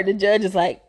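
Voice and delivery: ordinary delivery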